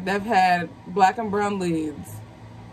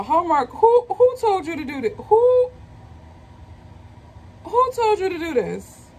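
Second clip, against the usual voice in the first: thrilled; 9 semitones higher than usual. Real, not acted. Calling out Hallmark, thrilled.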